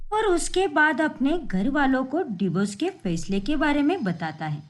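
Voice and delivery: plain reading voice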